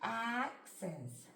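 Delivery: "Axes" stretched slowly, sound by sound. Shown as a demonstration. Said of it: "try sounding it out".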